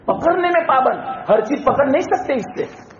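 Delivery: high-pitched, emphatic sermon voice; forceful preaching. Real, not acted. Preacher telling believers they cannot do as they please, forceful. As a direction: press each restriction home.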